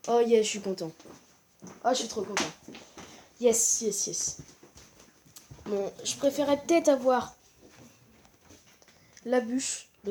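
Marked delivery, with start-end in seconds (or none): none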